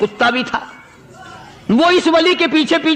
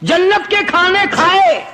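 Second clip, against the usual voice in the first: higher, ringing sermon voice; fervent preaching; 6 semitones higher than usual. Acted. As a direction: drive the point home from the pulpit. Dog's retort preached at full force. (جوش سے وعظ کرتے ہوئے)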